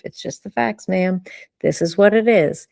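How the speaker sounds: ordinary delivery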